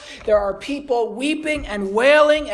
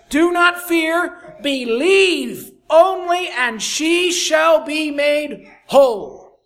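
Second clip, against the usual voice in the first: higher, louder, slow and deliberate; emphatic preaching; 6 semitones higher, 6 dB louder, slower than usual. Acted. Preacher proclaiming Jesus' promise to Jairus, loud and slow. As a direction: proclaim each word to the hall.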